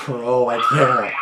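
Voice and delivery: loud, drawn-out cry of disbelief; spooked, stretch it out loud and high; real dread. Real, not acted. Reacting to a creepy voicemail recording.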